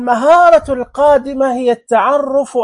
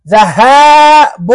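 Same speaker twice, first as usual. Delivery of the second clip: ذهاب stretched very slowly and louder; drawn-out nunation; 3 semitones higher, 7 dB louder, much slower than usual. Shown as a demonstration. Teacher to pupils, ذهاب drawn out loud. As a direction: draw out ذهاب loud and slow. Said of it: "التنوين بالضم".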